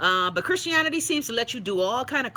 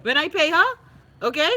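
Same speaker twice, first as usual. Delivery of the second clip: higher, louder insistence; emphatic boast; 8 semitones higher, 6 dB louder than usual. Real, not acted. About paying her Obeah woman, loud emphatic boast. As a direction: lean into it proudly.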